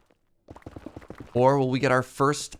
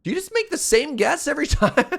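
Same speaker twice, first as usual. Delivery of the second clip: laughing disbelief; 14 semitones higher, 6 dB louder than usual. Real, not acted. Chat keeps guessing seven.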